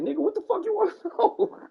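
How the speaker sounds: high, loud, laughing "what the fuck"; irritated mock retort. Answering the nosy neighbor, high laughing mock outrage. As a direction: snap back in mock outrage, cracking up.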